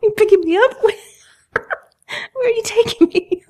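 high, giggling surprise while being lifted; laughing surprise. Acted. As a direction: squeal with delighted surprise.

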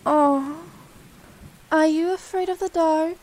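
ordinary talk, nothing marked

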